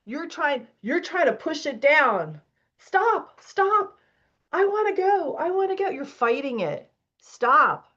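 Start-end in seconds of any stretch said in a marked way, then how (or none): 2.8-4.0 high, sharp commanding stop; firm stop. Real, not acted. Coach cutting off resistance. 4.5-5.9 high, whining protest; whiny resistant voice. Retold or imitated. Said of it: "Latoya's resistance voiced".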